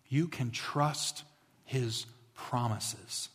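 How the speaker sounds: very slow, pauses between phrases; deliberate, weighted delivery; slow and emphatic. Real, not acted. Preacher stresses his key point.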